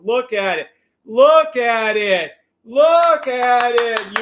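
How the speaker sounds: slow, loud, drawn-out look at it; excited look at it. Real, not acted. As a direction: stretch each word out, thrilled. Excited trader pointing at gains.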